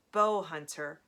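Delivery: plain reading voice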